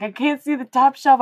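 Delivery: high, loud mock kid voice; imitated kid. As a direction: lightly mimic a child calling up, high and loud. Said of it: "She's like".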